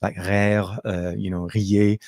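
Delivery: uvular R in "rire" and "riez"; French R rolled in the throat; French accent. Shown as a demonstration. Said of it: "that French accent".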